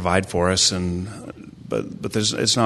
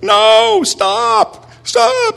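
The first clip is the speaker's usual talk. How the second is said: high, loud imitation of a frantic boy; panicked child shouting; 9 dB louder, much slower than usual. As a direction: yell like a kid stopping a runaway. Son shouting after running sister.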